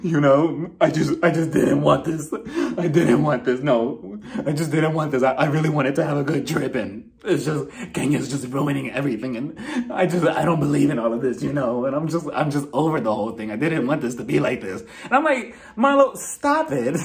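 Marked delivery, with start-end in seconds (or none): 0.0-14.8 put-on tearful victim voice; mock crying. Marlo playing the victim after going inside, mock crying. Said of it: "playing the victim for the hundredth time and crying". 15.8-17.0 high, drawn-out scolding; exasperated. Retold or imitated. Telling off Marlo for playing the victim, exasperated.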